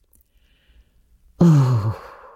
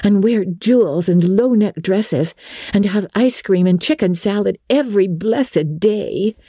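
higher, chatty schoolgirl voice; a girl dreaming aloud of riches; Jane; 6 semitones higher than usual. Acted.

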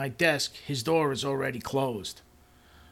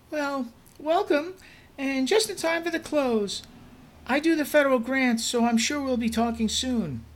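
much higher, bright cheerful coworker voice; bubbly coworker; 14 semitones higher, 4 dB louder than usual. Acted. Helga introducing herself to the new boss, much higher cheerful voice. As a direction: greet the new boss sweetly and eagerly.

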